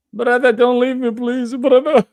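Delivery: high whiny begging, hammed up; hammed-up whine of don't leave me; mock pleading. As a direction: plead like an abandoned friend, in mock. Mocking a guest who left.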